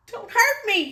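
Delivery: high, loud frightened plea; whimpered. Acted. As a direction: beg not to be hurt, scared. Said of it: "he whimpered".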